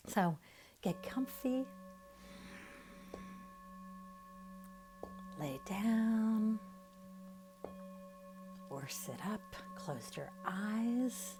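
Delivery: soft, unhurried meditation voice with long pauses; guided meditation. Real, not acted. Teacher guiding listeners to relax their face and jaw, soft meditation voice. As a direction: ease them gently into relaxation, leaving room to breathe.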